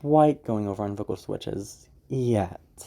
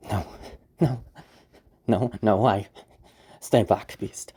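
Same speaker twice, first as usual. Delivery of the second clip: panicked; 3 semitones lower, slower than usual. Shown as a demonstration. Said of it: "towards losing that composure".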